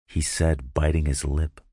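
plain reading voice

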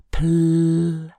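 loud, held-out first sound; leading prompt. Acted. Narrator offering the man a merciful hint, leading prompt. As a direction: hang on the start of the word as a hint. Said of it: "I offered him the prompt".

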